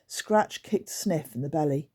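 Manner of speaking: usual speaking voice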